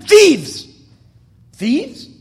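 a sudden indignant shout; loud scornful outburst; outraged cry. Acted. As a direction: spit out the word in disgust. Outraged inner thought about thieves.

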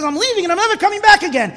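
high, fast, loud retold threat; angry outburst. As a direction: act out a furious walkout. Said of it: "he's so angry".

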